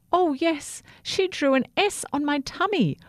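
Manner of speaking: high, eager and proud. A narrator's reading. Toothbrush proudly telling the pink toothbrush about Sophia. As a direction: beam while answering.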